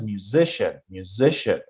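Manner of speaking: muSIcian, middle syllable stressed; musician with a light "mu" and a strong "zi"; correct stress. Shown as a demonstration. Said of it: "not musician".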